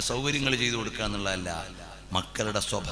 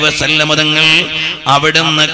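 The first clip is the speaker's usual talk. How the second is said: preaching; 6 semitones higher, 19 dB louder, slower than usual. Acted. Sermon chanted at full force.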